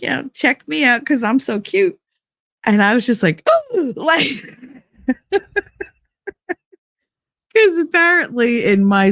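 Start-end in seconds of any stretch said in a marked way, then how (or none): 3.9-5.8 sharp high gasp then a squeaky laugh; shocked gasp. Retold or imitated. Catching her reflection in the window, gasp and laugh. Sees herself in shop window.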